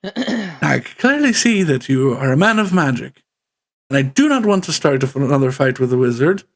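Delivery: wary, then suddenly blunt; wary npc. Acted. GM voicing the gang leader, wary of the wizard, then demanding.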